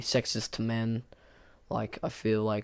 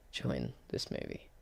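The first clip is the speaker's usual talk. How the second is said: whispered, much lower and softer; whispered aside; 12 semitones lower, 13 dB quieter than usual. Real, not acted. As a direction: drop to a whisper for the jab. Whispered aside mid-sentence.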